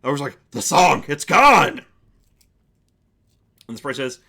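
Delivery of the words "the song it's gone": loud ogre exclamation; booming ogre shout of surprise; astonished ogre. Acted. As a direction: burst out in amazed relief as an ogre. Ogre discovers the song stopped.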